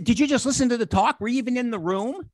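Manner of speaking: acting out disbelief at a silly question; mock exasperated. Retold scolding of fan at table.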